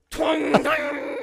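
high, loud twanging noise; mock twang. Describing the guitar landing to his co-host, a loud high twang. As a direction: shout the twang like strings slamming the ground. Imitating guitar hitting ground.

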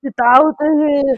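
high, loud action-star impression; Arnold Schwarzenegger. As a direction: belt out a hammed-up Austrian strongman imitation. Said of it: "You'll be able to do a better impression".

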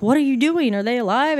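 frantic questioning. Retold or imitated. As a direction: re-enact an anxious demand for news. Acting out frantic question at crash.

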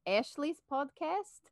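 hammed-up Russian accent, rising high and loud at the end; over-the-top Russian accent, half joking; mock Russian accent. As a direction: play a cartoon Russian, exclaiming. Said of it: "I talk in the Russian accent".

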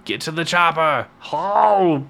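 high, hammed-up action-hero yell; mock movie yell. Host mocks an old Mister Freeze portrayal, hammed-up yelling. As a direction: yell it like an over-the-top movie impression. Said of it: "you know, yelling".

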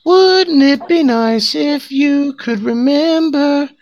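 high, slow, dreamy lilt; high sing-song. Acted. Daydreaming about a clip of politicians' hypocrisy, high and slow. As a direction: float it up like a fantasy.

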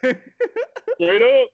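[name] ad lib. Retold or imitated. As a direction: toss it off like an auto-tuned ad lib. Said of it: "in the [name] auto-tune".

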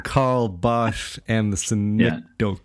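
Karl Barth with "sh", synecdoche ending on "doke"; slowly mangle Karl Barth and synecdoche as "Bosh" and "doke"; mock mispronunciation. Host mocking distance education students, slow mock mispronunciation. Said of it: "we don't wanna be no distance education students".

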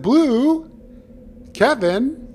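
high, loud sing-song call. Acted. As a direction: call the fish up from the pond. Calls one more time.

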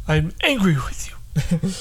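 whispered fake anger; mock angry whisper.

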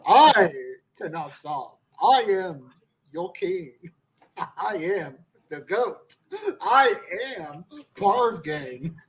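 booming wrestling promo, proud and slow; roaring tyrant king. Acted. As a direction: proclaim yourself king of the ring. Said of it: "his fifth promo of the show".

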